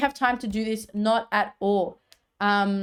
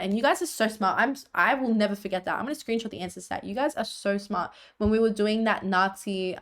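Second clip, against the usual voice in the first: usual speaking voice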